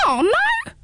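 very high indignant hedgehog squeal. Acted. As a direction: squeal it, ruffled and prickly. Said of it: "squealed the hedgehog".

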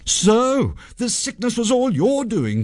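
high-pitched accusing outcry; indignant young hero, high voice; accusing cry. A narrator's reading. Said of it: "cried Sanjay".

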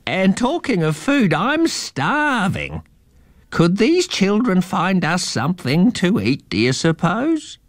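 put-on a slow, high old eagle; eagle-man voice. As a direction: agree with the mouse, drawn out. Eagle asks for food.

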